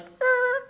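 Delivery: high, startled yelp of dismay; a high whimpering "er"; startled squeak. Retold or imitated. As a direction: squeak it out in shock. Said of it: "I was like".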